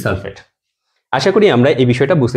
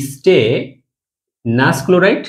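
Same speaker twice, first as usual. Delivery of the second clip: slow pronunciation; much slower than usual. Shown as a demonstration. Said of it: "আস উচ্চারণ করে থাকি।".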